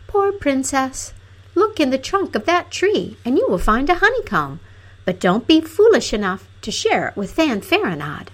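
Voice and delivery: high-pitched little fairy-tale flower; small voice pitched well up; little rose voice. A narrator's reading. Rose bush advising the crying princess, little voice. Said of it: "a soft little voice".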